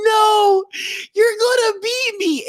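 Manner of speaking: high-pitched tearful protest, acted; hammed-up crying kid; mock crying. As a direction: wail like a bawling kid.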